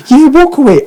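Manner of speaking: high mocking "walk away"; mock taunt. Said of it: "He's like".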